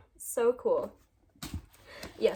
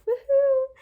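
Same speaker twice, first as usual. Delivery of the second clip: slow, much higher, louder woohoo; cheer; 12 dB louder than usual. Real, not acted. Vlogger wrapping up her haul for viewers, cheering. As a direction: whoop it out like a cheer.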